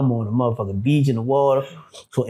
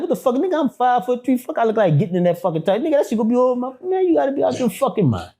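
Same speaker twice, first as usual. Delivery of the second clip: retold disbelief; 10 semitones higher, faster than usual. Incredulous reaction to dungeon threat.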